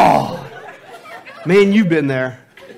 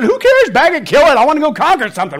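loud, much higher, fast barked lines; hammed-up impatient husband yelling; mock caveman shout; 7 semitones higher, 7 dB louder than usual. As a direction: yell it like a hunter impatient to kill. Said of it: "And we said".

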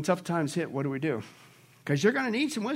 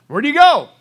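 ordinary talk, nothing marked

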